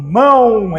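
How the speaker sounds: mão stretched with a final U sound; demonstrated mão, O heard as U; correct mão. Shown as a demonstration. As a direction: say mão as "mãu", held long. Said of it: "Esse O tem som de U".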